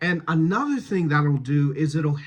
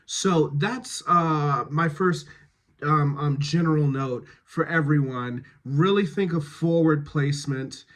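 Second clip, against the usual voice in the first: usual speaking voice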